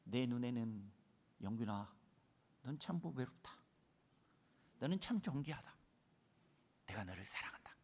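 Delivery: soft, low, tender words of God; softly. Acted. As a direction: speak gently to yourself. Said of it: "주님이 이렇게 말씀하신 것 같아요". (조용히 속삭이는 주님의 음성)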